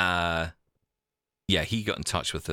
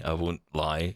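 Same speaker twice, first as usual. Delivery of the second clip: usual speaking voice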